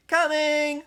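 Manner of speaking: high, loud shouted reply. A narrator's reading. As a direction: yell it out the window.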